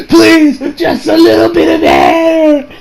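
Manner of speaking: high, slow, hammed-up begging; pleading. Retelling the trapped villain asking for air, desperate pleading. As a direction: beg in a drawn-out high mock wail.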